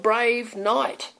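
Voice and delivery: ordinary delivery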